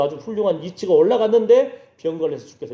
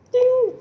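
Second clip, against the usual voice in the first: high, sustained "ding"; imitated ding; much slower than usual. Preacher showing God's sudden sign, imitated ding. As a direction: let a high-pitched ding ring out.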